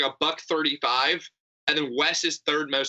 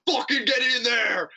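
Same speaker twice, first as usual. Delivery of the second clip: mock sports dad yell; 6 semitones higher than usual. Mock sports dad yelling in booth.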